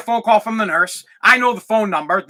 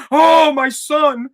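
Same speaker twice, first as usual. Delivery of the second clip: hammed-up slow wail of parental worry; helicopter parent; 5 semitones higher, much slower than usual. Mocking the fretful parent the nurse expects, overwrought. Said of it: "gonna be all like".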